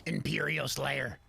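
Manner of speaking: ordinary talk, nothing marked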